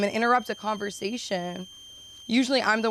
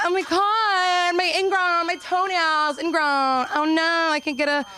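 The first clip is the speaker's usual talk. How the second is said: put-on girly voice; 7 semitones higher than usual. Comic mocking a girl fussing over her toenail. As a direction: ham up a fussy girly wail. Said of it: "a girly thing".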